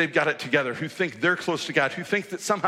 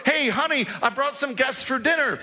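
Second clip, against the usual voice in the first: high, bright calling out to his wife; cheery husband; 10 semitones higher than usual. Pretend. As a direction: breeze in announcing the guests. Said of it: "when Zacchaeus runs through the door".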